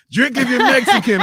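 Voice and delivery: loud, high imitation of a pushy stranger; mock shouted command; insistent retold order. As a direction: bellow it as the stranger who won't let up. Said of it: "he just kept saying".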